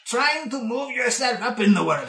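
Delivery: put-on nosy old shopkeeper sizing up a customer; gnarled old bookkeeper. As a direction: play a quirky ancient dwarf. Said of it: "not sure what he is".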